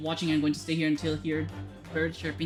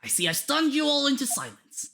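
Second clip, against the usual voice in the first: theatrical detective voice; 7 semitones higher than usual. Acted. Voices game dialogue.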